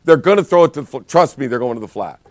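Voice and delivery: normal talking voice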